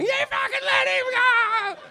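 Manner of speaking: screamed high and loud; high shrieking scream from Marty's wife; retold shriek. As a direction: shriek it like a wife on his back.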